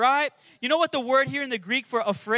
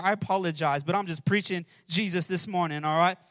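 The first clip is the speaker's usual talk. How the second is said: ordinary talk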